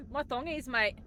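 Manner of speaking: hammy Aussie twang on thongies; Australian accent. Pretend. Streamer jokes to chat in mock Australian accent. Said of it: "that absolutely terrible Australian accent".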